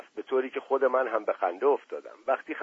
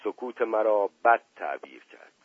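usual speaking voice

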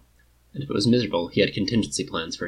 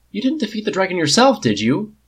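fast, high and loud challenge; blunt; 10 semitones higher, 5 dB louder than usual. Acted. Prince bluntly challenges the king's dragon story, fast and loud. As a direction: say it straight out, no courtesy. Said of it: "Tony asked bluntly".

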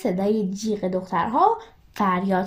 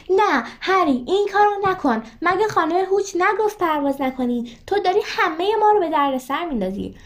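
shouting; 8 semitones higher than usual. A narrator's reading. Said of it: "هرماینی گرنجر فریاد زد". (فریاد نگران و زیر)

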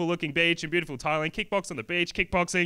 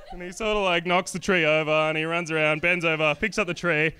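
normal talking voice

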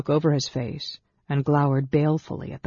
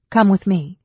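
raised, loud order; commanding; 5 semitones higher, 8 dB louder than usual. A narrator's reading. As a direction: order them to follow at once. Commander orders companions along.

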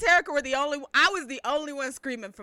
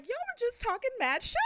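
high, incredulous complaint; retold indignation; 12 semitones higher than usual. Onlooker calling out the fake fans, retold indignation. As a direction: say it high in comic disbelief.

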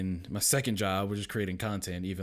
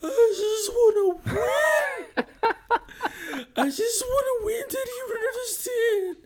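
much higher, louder, slow mock sobbing; crying little girl; 8 dB louder, slower than usual. Daughter crying in the corner, mock sobbing wail. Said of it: "She's like".

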